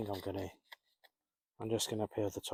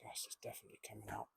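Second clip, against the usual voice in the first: much softer, fast under-breath repeat; muttered repeat; 12 dB quieter, much faster than usual. Real, not acted. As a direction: mutter it to yourself.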